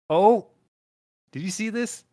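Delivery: high, loud gasp of discovery; sudden delighted surprise; surprised. Real, not acted. Spots surprising username.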